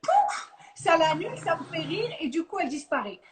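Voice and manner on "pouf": squeaky pouf. Pretend. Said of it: "je lui mets une petite voix de dessin animé".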